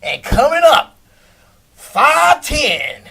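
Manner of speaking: loud, slow, drawn-out ring announcer call; arena announcer calling a fighter to the ring; stadium announcer. Retold or imitated. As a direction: boom it out like a boxing intro. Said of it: "like those announcers at the arena or the stadium".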